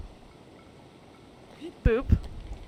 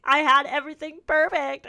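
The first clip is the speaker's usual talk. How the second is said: 1 mock crying; 5 semitones higher, 6 dB louder than usual. Real, not acted. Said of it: "I'm gonna cry".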